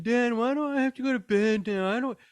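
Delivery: high, loud bedtime whine; mimicked whining. Parent mimicking child's bedtime complaint to Dad, whining. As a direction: imitate a kid whining in protest. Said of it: "you whine".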